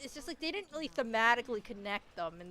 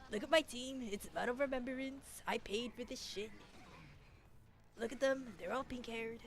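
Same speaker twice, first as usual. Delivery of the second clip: Donald Trump impression; 5 dB quieter than usual. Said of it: "my Donald Trump impression".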